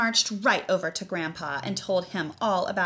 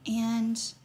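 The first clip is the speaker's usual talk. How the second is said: usual speaking voice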